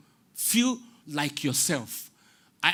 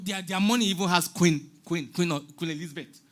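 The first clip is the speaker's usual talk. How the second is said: ordinary talk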